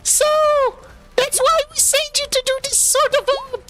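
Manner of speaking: very high, dismissive boss voice; condescending high voice. Acted. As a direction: talk down to a useless employee. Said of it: "she says".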